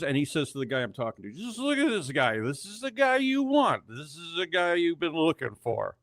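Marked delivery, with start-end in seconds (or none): 1.3-6.0 put-on higher, slurred drunk pointing someone out; a tipsy stranger selling his find; lightly voiced drunk. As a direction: drunk man at a party vouching, higher voice.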